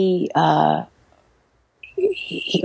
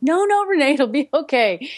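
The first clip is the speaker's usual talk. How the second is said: high-pitched imitated reassurance; calming friends; 10 semitones higher than usual. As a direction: soothe a panicker in a much higher voice. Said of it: "trying to calm me down".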